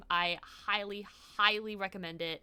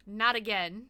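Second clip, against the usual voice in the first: ordinary talk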